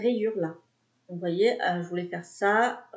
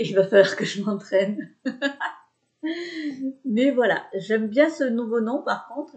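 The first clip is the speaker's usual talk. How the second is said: usual speaking voice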